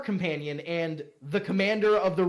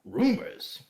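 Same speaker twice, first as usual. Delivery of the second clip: 1 scoffing; 6 semitones higher than usual. Acted. Offended lord scoffs at rumors.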